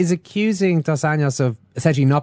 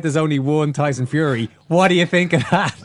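retold shout; 5 semitones higher, 4 dB louder than usual. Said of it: "shouts out".

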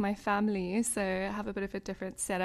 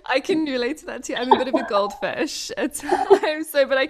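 laughing; 4 semitones higher, 8 dB louder than usual. Real, not acted. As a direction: talk through a real laugh. Host laughing along with guest.

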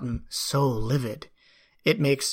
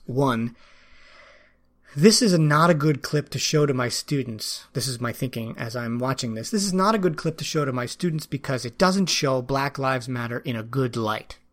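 ordinary delivery

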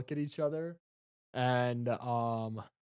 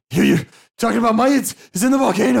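high, loud pained shout; strained, frantic prisoner voice; panicked confession; 7 semitones higher, 10 dB louder, faster than usual. Acted. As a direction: blurt the answer out in a rush. Wounded prisoner blurts the answer.

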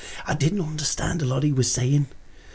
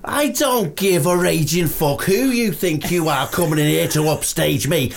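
hammed-up indignant outburst; outraged diva tirade; 2 semitones higher than usual. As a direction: rage at the intruder upstaging you. Diva throws out an intruder.

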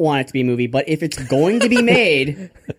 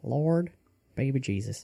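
lightly voiced character, much softer; Father Grandfather; 4 semitones lower, 11 dB quieter than usual. Player rehearsing his character's sound, quiet and tentative. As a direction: feel out the voice under your breath. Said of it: "how he sounds".

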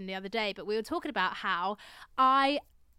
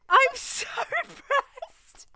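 amazed gasp; 13 semitones higher, 3 dB louder, much slower than usual. Real, not acted. Amazed at the result.

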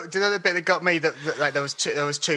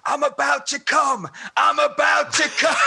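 higher, louder imitation of a crowd yell; imitating a fan yelling in the background; mock fan yell; 10 semitones higher, 4 dB louder than usual. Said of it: "one guy going".

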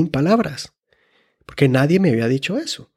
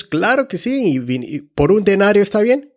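higher-pitched, eager voices of hired workers; eager agreement; 7 semitones higher than usual. Acted. Jobless workers accept the patron's offer, eagerly agreeing. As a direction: act out keen workers jumping at an offer.

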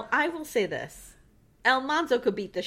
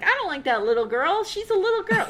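high protest breaking into a laugh; mock protest; 8 semitones higher, 4 dB louder, faster than usual. Almanzo protesting to Charles, high laughing protest. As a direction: insist on it, laughing. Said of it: "Almanzo's like".

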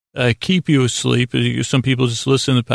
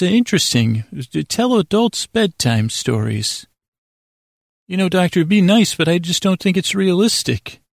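a hint of a higher, polite official brushing him off; lightly voiced receptionist; 5 semitones higher than usual. Institute clerk turning down the doctor, mild higher polite voice. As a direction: politely let him down, pitched up a little.

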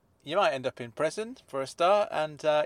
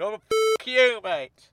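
angry snap; 5 semitones higher, 3 dB louder, much slower than usual. Acted. Angry insult.

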